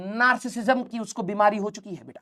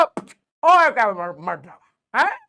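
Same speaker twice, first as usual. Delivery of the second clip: sharp call-out; slower than usual. Real, not acted. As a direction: snap a student back to attention. Scolding a distracted student.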